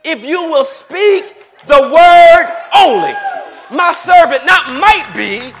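high, stretched-out declaration; climactic preaching shout. Acted. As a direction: build to a loud, drawn-out peak of faith.

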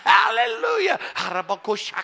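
high-pitched hallelujah shriek; imitated screaming praise. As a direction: mimic an overjoyed woman shouting praise. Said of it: "She's screaming, shouting".

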